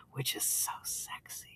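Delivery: unvoiced, soft, seductive whisper; sultry whisper. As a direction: whisper it sexily. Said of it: "in your sexy voice".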